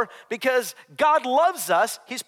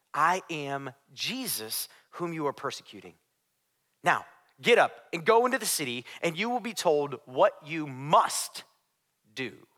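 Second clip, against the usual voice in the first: Jesus; 7 semitones lower, 4 dB quieter than usual. A narrator's reading. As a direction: reveal yourself quietly, with weighty pauses. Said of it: "heard a voice say to him".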